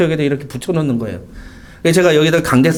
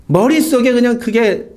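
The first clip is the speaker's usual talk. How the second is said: ordinary delivery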